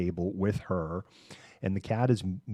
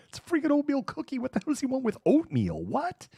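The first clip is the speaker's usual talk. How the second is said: much higher, fast incredulous rant; baffled outburst. Retold or imitated. Owners baffled by the cat craving oatmeal, much higher. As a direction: squeal in disbelief at the cat's taste.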